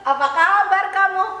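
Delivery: ordinary delivery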